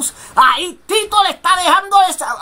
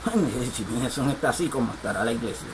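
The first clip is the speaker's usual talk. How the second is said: imagined visitor's thought; 12 dB quieter, faster than usual. Retold or imitated.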